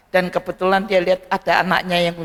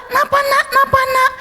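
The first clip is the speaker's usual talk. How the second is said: much higher anxious asking; worried mother; 3 dB louder than usual. Acted. Mother hurrying out to her crying son, higher anxious voice. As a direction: rush the question like a worried mum. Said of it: "Langsung dia cepet-cepet keluar".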